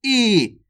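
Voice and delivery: "ई" stretched out very slowly; long stressed vowel. Shown as a demonstration. Teacher demonstrating effort in big ई, long drawn-out "ई". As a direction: hold a long, pressed "ई". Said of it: "इसको उच्चारण में जोर लग रहा है".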